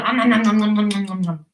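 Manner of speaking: fast babbled om-noms; greedy munching. Acted. Reader mimics baby dinosaurs gobbling their meat, greedy munching. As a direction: gobble it up in a rapid run of chomps. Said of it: "they ate it up as fast as they could".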